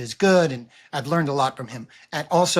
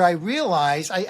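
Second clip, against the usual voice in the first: ordinary talk, nothing marked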